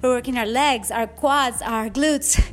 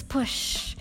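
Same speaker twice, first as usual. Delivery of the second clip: breathy push cue; 7 dB quieter than usual. Shown as a demonstration. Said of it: "coaching a push".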